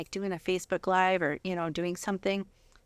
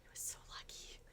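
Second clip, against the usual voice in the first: breathy confiding whisper; 23 dB quieter than usual. Real, not acted. Wife confiding about her husband in a whisper. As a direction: whisper it like a happy secret.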